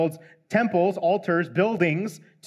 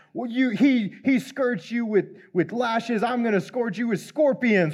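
raised, higher boast of a haughty king; mock royal yell; 5 semitones higher than usual. Rehoboam threatening the people, higher, half-hammed yell. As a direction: throw the threat out high like a bragging king. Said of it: "he yells this out to the people".

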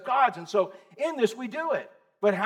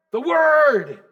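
"The word" called out louder, falling on "word"; loud answer; 5 semitones higher, 9 dB louder than usual. Shown as a demonstration. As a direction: proclaim "The word" like the answer to a call. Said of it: "The what?".

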